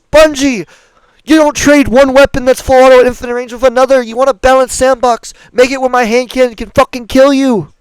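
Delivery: real fed-up rant, pitched high; angry rant. Real, not acted. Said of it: "I'm sorry for the rant".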